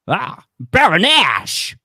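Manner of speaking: slow, loud Scottish "Ah, Baroness!" as Sean Connery; Sean Connery impression. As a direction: stretch it out and call to the Baroness in a Scottish accent. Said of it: "integrate the Yash".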